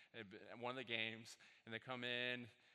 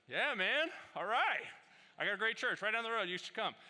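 much higher and louder, jovial; excited reply; 10 semitones higher, 10 dB louder than usual. Retold or imitated. Pastor inviting the dad to his church, high, loud and jovial. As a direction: laugh it off, big friendly invite. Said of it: "I was like".

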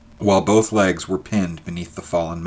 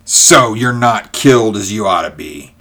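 crying out; 7 dB louder than usual. A narrator's reading. George jeering at his trapped enemy, crying out. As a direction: call it out loudly at a trapped enemy. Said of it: "he cried".

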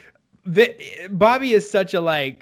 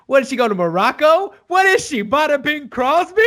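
higher, louder mocking Paulie; imitating Paulie; 8 semitones higher, 5 dB louder than usual. Paulie mocks Tony's girlfriend's trip, high mocking voice. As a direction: tease like Paulie needling Tony. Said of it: "and Paulie goes".

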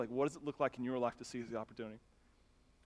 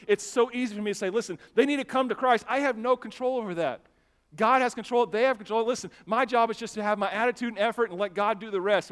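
loud, higher-pitched sermon drive; impassioned preaching; 6 semitones higher, 7 dB louder than usual. Real, not acted. Pastor telling church God controls the outcome, impassioned preaching. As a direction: drive the point home to the congregation.

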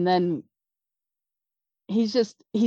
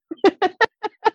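a sudden high laugh that breaks out; overwhelmed laugh; high burst of laughter; 5 dB louder than usual. Real, not acted. Overcome retelling meeting Jack Matson.

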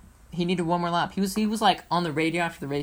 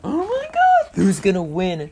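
excited disbelief; 3 semitones higher, 7 dB louder, slower than usual. Retold or imitated. Upside down watching the last laps, squealing excited yelp. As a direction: yelp it like you're glued to the finish. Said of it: "looking at the screen like".

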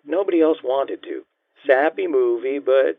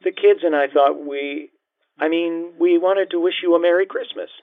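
higher-pitched woman, hesitant and tentative; a tentative, higher voice, restarting mid-thought; unsure; 8 semitones higher than usual. A narrator's reading. Mother calls her ex-husband from Florida, unsure. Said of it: "Her voice sounded unsure".

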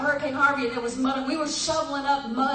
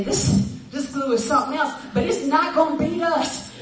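a touch louder, gritty resolve against the glue; mock determined; 5 dB louder than usual. Pastor retelling the crew's vow against the glue, mock determined. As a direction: play it like you won't be beaten by the job. Workers egging each other on.

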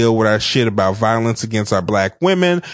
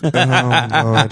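laughing. Real, not acted. Laughing at movie titles.

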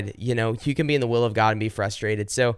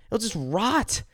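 high, sorrowful plea; lightly raised prayer voice; pleading prayer; 9 semitones higher, slower than usual. Acted. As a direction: let the words fall in grief. Impassioned prayer.

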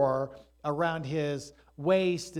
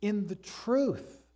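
slow, rising solemn quotation; quoting Jesus; 4 semitones higher, slower than usual. Retold or imitated. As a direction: let each word land. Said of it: "Jesus said".

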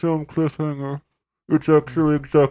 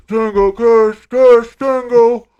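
louder; 6 semitones higher, 6 dB louder, slower than usual. Acted. Guest chants the names, building louder.

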